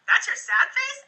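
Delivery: mock disbelief. Shown as a demonstration. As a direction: tease them into laughing. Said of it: "I'll go".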